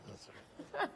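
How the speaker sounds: breathy laughing repeat. Real, not acted. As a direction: laugh it off softly. Laughs off being misquoted.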